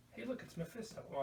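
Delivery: ordinary talk, nothing marked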